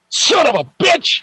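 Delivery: high-pitched, cartoonish cry of disbelief; mock outrage. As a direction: squeal it like you've just been fooled. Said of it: "And I'm like".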